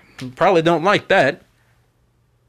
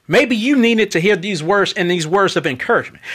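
usual speaking voice

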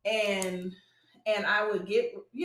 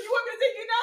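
mama offering; 12 semitones higher, 4 dB louder, much faster than usual. Retold or imitated. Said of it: "And she's like".

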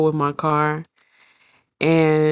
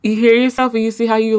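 normal talking voice

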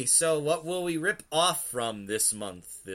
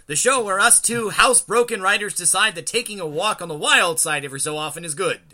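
hyped announcer read; 8 semitones higher, 9 dB louder, faster than usual. Acted. Continuing announcer intro.

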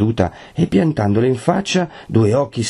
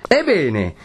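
high, heated retort; distraught cry; 7 semitones higher than usual. A narrator's reading. Renzo answers his betrothed, crying out distraught. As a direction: burst in, beside yourself. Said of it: "gridò Renzo con un viso più che mai stravolto".

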